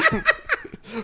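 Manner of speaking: sudden very high burst of laughter; real laugh bursting out high; high squeal laugh. Real, not acted. Cracks up mid-read.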